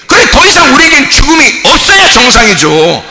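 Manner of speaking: fervent sermon, half shouted; preaching at full force. Acted. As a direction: drive each point home to the pews. Preaching to the congregation.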